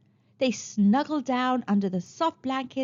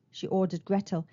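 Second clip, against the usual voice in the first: ordinary delivery, nothing marked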